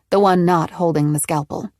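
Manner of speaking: plain reading voice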